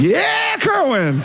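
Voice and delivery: high-pitched mock shout; acted cheer. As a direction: whoop it out like a fan. Acts cheering at Kerwin.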